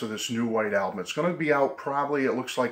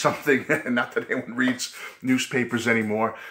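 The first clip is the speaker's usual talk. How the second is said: ordinary talk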